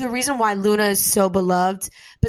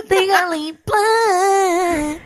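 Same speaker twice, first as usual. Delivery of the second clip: high, slow, sing-song laughing echo; laughing disbelief; 9 semitones higher, 5 dB louder, much slower than usual. Real, not acted. Friend echoing the movie choice, laughing and singing it out. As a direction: stretch the title out through laughter.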